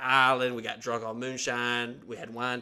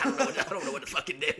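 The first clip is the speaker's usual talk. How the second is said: rushed, laughing through the words; laughing panic; 3 semitones higher, much faster than usual. Retold or imitated. Son retelling his panic at the table, fast and laughing. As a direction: gasp it out fast between laughs.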